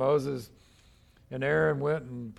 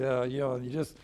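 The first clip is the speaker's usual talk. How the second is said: ordinary talk, nothing marked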